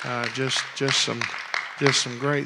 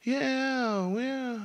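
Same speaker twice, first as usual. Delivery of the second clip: put-on high, slow little girls' squeal of joy; imitating girls hollering; 10 semitones higher, much slower than usual. Little girls hollering to grandpa, high and excited. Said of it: "So they started hollering".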